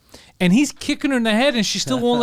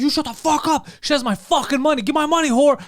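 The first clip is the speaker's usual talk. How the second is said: mock angry yell; 7 semitones higher, 3 dB louder than usual. Pimp yelling at couple.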